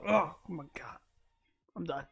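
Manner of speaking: usual speaking voice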